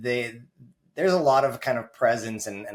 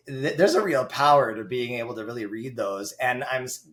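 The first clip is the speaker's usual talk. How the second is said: normal talking voice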